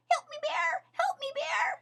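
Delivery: high, panicky rabbit calling out; a rabbit adrift, crying for help; high frantic plea; frightened cry for help. A narrator's reading. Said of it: "she cried".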